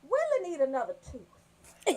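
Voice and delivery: ordinary delivery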